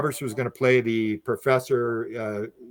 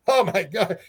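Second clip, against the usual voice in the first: higher, retold shock; shocked exclamation; 6 semitones higher than usual. Man seeing friend with rifle, shocked retold exclamation. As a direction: imitate a shocked reaction.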